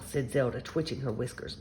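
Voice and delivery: ordinary delivery, nothing marked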